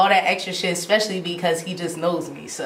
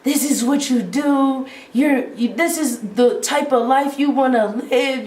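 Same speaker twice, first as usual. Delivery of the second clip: higher-pitched retold scolding; imitating mad dad; 5 semitones higher than usual. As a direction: play dad telling off his daughter. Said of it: "my dad was so mad".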